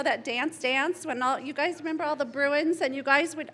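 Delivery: ordinary talk, nothing marked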